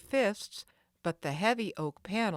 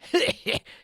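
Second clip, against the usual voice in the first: villain cackle; 10 semitones higher, 8 dB louder than usual. Acted. Villain laughs at captive.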